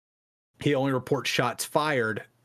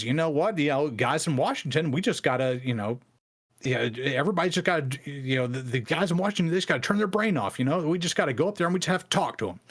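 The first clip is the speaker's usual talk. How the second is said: put-on higher, faster, easygoing good-ol'-boy voice; super friendly Bo Greitz; 4 semitones higher, faster than usual. Bo Greitz tells the feds in Washington to relax, friendly and fast. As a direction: play the nice guy who just wants to talk. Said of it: "super friendly".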